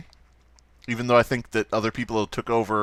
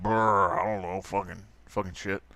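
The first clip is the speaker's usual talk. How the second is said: southern zombie; 3 semitones lower, 5 dB quieter than usual. Retold or imitated. Zombie answers the robot in a southern accent. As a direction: mumble it like a dim southern zombie. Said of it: "I don't know why the zombie's southern".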